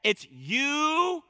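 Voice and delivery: "you" drawn out and rising high; emphatic YOU. Shown as a demonstration. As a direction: lean hard on "you", stretching it upward. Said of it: "that's emphatic".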